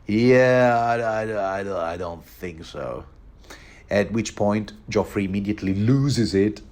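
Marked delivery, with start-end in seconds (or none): 0.1-3.1 put-on drunk Tyrion. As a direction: mumble it like a drunk. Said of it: "he just says something along".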